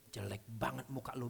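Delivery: slow, low, lightly jeering taunt; half-hearted tease at a corpse; mock insult. Shown as a demonstration. Preacher demonstrating mocking a dead man, slow and low. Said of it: "bilang sama dia".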